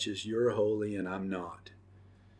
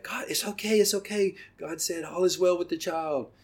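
urgent reassurance; 9 semitones higher than usual. Retold or imitated. Husband running to frightened bleeding wife, urgently reassuring. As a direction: rush in to calm her, voice pitched way up.

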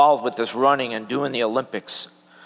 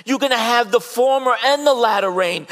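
high, loud, driving sermon voice; full-force pulpit preaching; fervent preaching; 9 semitones higher, 6 dB louder than usual. Acted. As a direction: preach it out with mounting fire.

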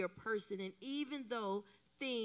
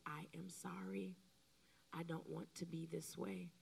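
soft apology; 2 semitones lower, 10 dB quieter than usual. Shown as a demonstration. Modeling a wife's apology at the doorway.

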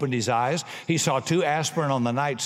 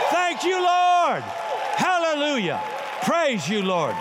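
shouted praise; 10 semitones higher, slower than usual. Real, not acted. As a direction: lift it up to the Lord. Preacher shouts praise to God.

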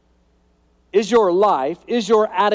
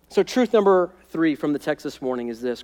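normal talking voice

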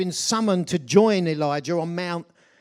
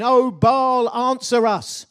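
loud, higher mock cry to a god; imitated shouting; 6 semitones higher, 8 dB louder, slower than usual. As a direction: call out as the pagan crowd pleading with Baal. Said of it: "it says, shouting".

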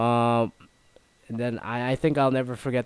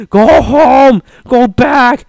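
full-throated shout, much higher and louder; retold yell; 12 semitones higher, 13 dB louder, much slower than usual. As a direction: bellow it at the protesters. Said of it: "one guy yelled back".

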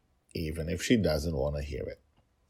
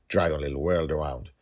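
ordinary delivery

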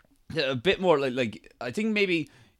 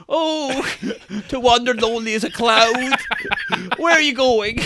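much higher, mock-lyrical; poetic groom; 8 semitones higher than usual. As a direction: recite it high like a moony poet. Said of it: "He's like".

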